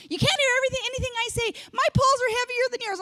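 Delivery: high, whining mock complaint; whiny sister. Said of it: "She doesn't really talk like this".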